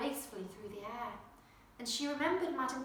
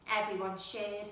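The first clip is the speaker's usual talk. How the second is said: usual speaking voice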